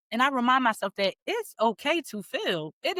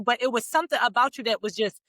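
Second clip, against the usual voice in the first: ordinary talk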